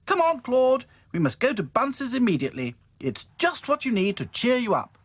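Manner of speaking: eager, calling everyone on; excited cry. Acted. Dotty urges the dolls in an excited cry.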